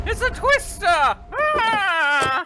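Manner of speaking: put-on high screaming panicked farm girl; Wizard of Oz panic. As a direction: shriek it like a movie heroine. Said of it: "Ah, that's a joke".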